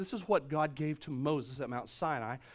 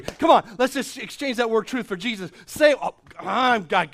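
louder, rising excitement; real preacher's excitement; excited; 5 semitones higher, 11 dB louder than usual. Real, not acted. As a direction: let the thrill break through. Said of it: "I'm getting excited about this".

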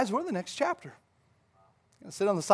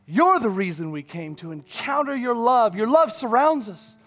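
fervent worship declaration; 4 semitones higher, 7 dB louder than usual. Real, not acted. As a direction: build to an ecstatic preacher's climax. Declares worship at full force.

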